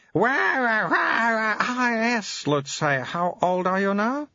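put-on very high, babyish old abbot voice; an ancient mind in a baby's mouth; high piping infant abbot; baby-bodied old abbot.

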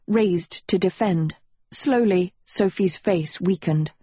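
ordinary delivery, nothing marked

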